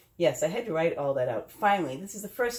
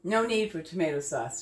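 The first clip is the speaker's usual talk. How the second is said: ordinary talk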